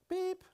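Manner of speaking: put-on a short, very high "beep" like an old tape recorder; imitated tape beep. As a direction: squeak it out like a machine beep. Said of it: "whenever the tape recorder would beep".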